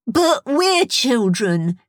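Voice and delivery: put-on high, drawn-out childish insistence; insistent child. A narrator's reading. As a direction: insist slowly in a small, high voice. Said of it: "insisted Stront".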